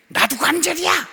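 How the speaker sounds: high, loud mock cry of protest; shouting joint. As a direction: yell up high like a joint demanding notice. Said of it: "이러고 소리를 지르는 바람에".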